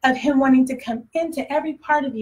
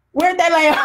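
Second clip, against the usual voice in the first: asked louder, half laughing; urgent; 5 semitones higher, 6 dB louder than usual. Retold or imitated. Jesus pressing to see Lazarus, louder and laughing. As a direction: push the question with a laugh. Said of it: "the next thing he says".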